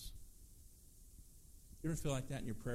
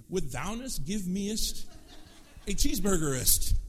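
hammed-up olde-English actor voice, slow and louder; mock Shakespearean; 5 semitones higher, 8 dB louder than usual. Ordering fast food like a mock Shakespearean actor. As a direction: ham it up like a pompous stage actor at the drive-through. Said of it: "like we become Shakespearean actors".

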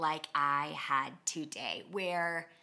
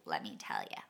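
much lower, darker, fading to a whisper; ominous low aside; 7 semitones lower, 5 dB quieter than usual. Retold or imitated. Warning Satan it's happening, low and menacing. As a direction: drop deep and menacing.

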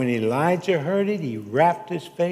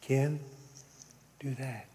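hushed, gentle prompting, trailing softer; soft whisper; 9 dB quieter than usual. Retold or imitated. Preacher voicing God's soft whisper to him, hushed. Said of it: "listen to the soft whisper".

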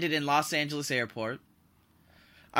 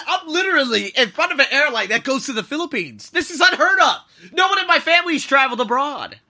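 higher and louder, excited disbelief; amazed to himself; 10 semitones higher, 11 dB louder than usual. Retold or imitated. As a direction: marvel at your own luck. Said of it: "And I told myself".

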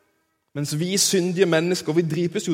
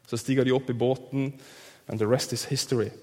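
normal talking voice

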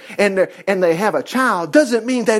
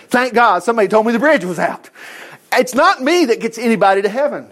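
ordinary talk

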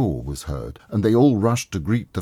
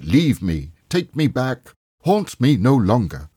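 loud, high, begging cry; desperate pleading; 6 semitones higher, 6 dB louder than usual. A narrator's reading. Scrooge pleading with the ghost, loud and desperate. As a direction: shout it out, begging to be freed.

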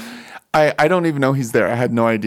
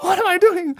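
much higher, panicked thought; panicked inner voice. Retold or imitated. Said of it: "in my mind, I'm like".

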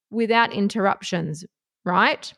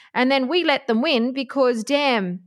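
normal talking voice

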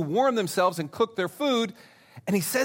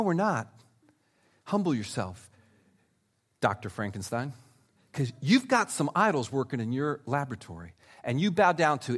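ordinary talk

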